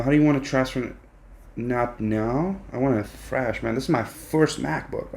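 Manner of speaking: normal talking voice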